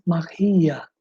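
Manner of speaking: "magia" stressed on the middle, ma-GI-a; Portuguese stress on GI. Shown as a demonstration. Teacher contrasting Portuguese, stress moved to GI. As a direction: lean on GI in "magia". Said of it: "em português".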